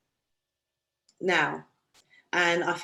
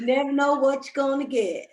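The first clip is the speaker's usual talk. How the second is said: Forrest Gump quote; 4 semitones higher, 5 dB louder than usual. Retold or imitated. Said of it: "As Forrest Gump said".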